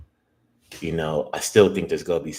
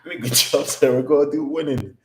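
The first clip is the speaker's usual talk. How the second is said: higher, louder, laughing giddy brag; bragging through laughter after a win; 8 semitones higher, 8 dB louder than usual. Real, not acted. Giddy after a winning trade.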